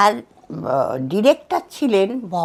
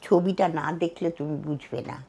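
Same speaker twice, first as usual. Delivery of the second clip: normal talking voice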